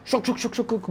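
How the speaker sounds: high, quick shuffling sound effect; imitated scrabbling noise. Storyteller scrambling uphill on all fours, imitated shuffle noise. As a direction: puff out rapid scrabbling syllables.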